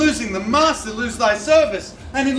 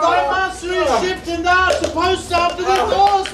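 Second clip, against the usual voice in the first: hurried servant Panthino; 4 semitones higher than usual. Acted. Panthino hurrying Launce aboard.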